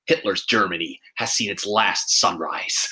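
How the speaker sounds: low, slow, solemn line delivery; Tom Cruise impression. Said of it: "in his typical Cruise way, delivering lines like".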